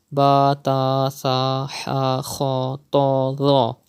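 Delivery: letters "ba, ta, sa" with long Arabic aa; Arabic letter pronunciation. Shown as a demonstration. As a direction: recite "ba, ta, sa, ha, kha" in Arabic accent, open vowels. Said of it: "pronounce them in the following way".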